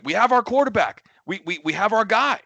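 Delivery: high, loud, emphatic fan proclamation; retold excitement. Retold past enthusiasm for the quarterback.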